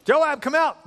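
loud, high-pitched summons; insistent calling out. Retold or imitated. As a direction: shout someone out of hiding. Benaiah calls again, more forcefully.

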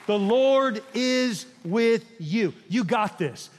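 loud, slow, drawn-out preaching; preaching at full force. Acted. Pastor assures his church, loud slow preaching. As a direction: hammer each word home to the church.

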